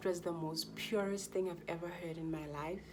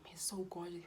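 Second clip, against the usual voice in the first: put-on whispered wonder at the baby; retold tender whisper; awed whisper; 7 dB quieter than usual. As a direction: breathe it as a new mother. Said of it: "That's the only words that came".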